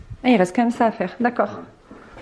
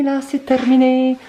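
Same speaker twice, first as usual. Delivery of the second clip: slow, loud, proud "terminé"; drawn-out announcement; 3 semitones higher, 4 dB louder, slower than usual. Real, not acted. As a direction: stretch it out triumphantly. Declaring the job done, drawn out.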